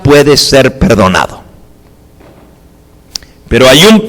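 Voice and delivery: loud, rising sermon voice, driving each phrase home; preaching at full force. Acted. Preacher warning the congregation about the unforgivable sin, loud and urgent. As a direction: proclaim it like a fiery preacher.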